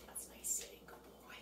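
soft whispered praise to the dog. Real, not acted. Trainer quietly praising the sitting puppy, whispering. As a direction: whisper praise so as not to excite him.

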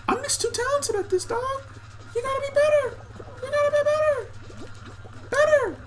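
very high, squeaky mock-taunt; high cartoon taunt, pushing them to do better.

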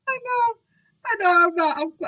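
high words squeezed out through laughing; real guilty laughter. Real, not acted. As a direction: admit it while cracking up. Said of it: "I know I shouldn't have laughed".

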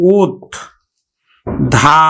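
ওত, then a long ধা; ওত and ধা as separate, held syllables; syllable-by-syllable pronunciation. Shown as a demonstration. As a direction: break the word into ওত and ধা. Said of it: "আমরা উচ্চারণ করব কিন্তু".

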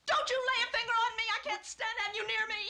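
fast, high-pitched hysterical outburst. Acted. Wife rejects husband's touch, hysterically angry. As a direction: recoil from a touch, words tumbling out. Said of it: "You're hysterical".

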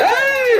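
high drawn-out "hey" cry; urging yell. Real, not acted. Commentator urging on racing bulls, high yell.

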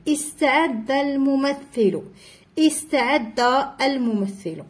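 slow استعد starting on a glottal i; initial hamza pronounced. Shown as a demonstration. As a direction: sound the opening i of استعد clearly. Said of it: "في أولها همزة تم النطق بها".

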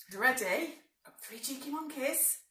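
usual speaking voice

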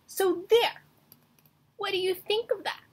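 high, loud and fast taunt; triumphant taunt. Acted. Mouse taunting the elephant, high and loud. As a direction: crow it in his face. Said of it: "said the mouse".

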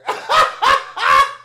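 high, breathless burst of laughter; shrieking laugh. Real, not acted. Reacts to the [name] diss.

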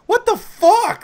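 high, loud retold protest; loud, squeaky incredulity; exasperated disbelief. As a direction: voice shoots up in baffled protest. Exasperated reply in retold quarrel.

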